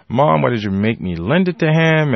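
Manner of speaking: retold railing. Child blaming mother for the loan, indignant railing. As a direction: wail in protest, half in earnest. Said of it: "start railing".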